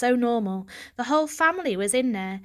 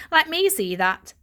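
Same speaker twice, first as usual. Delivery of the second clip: louder, eager demand; father wanting the toy; 6 semitones higher, 5 dB louder than usual. Acted. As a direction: reach for it eagerly.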